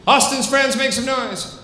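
high-pitched showman's call; rallying the crowd. Real, not acted. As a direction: call out high to whip up applause.

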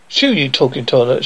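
plain reading voice

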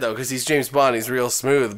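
ordinary talk

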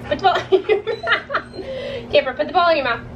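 giggling through the instruction; laughing while giving the dog commands. Real, not acted.

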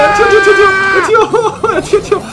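panicked high shouts while fleeing; frantic yelling to run; being chased. Real, not acted.

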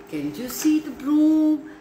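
usual speaking voice

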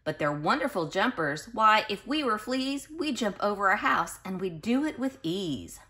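lilting read-aloud storybook voice; sing-song storybook reading; reading to children. Acted. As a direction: read it like a bedtime picture book to little kids. Reads picture book to children.

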